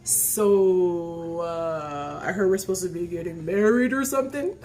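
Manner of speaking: drawn-out, low impression of a man on the phone; Charles. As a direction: imitate a laconic guy easing into it. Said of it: "And then he says this".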